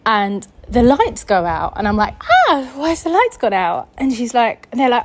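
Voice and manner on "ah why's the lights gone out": higher-pitched startled question; retold alarm. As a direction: act out being caught in the dark. Retold reaction to lights going out.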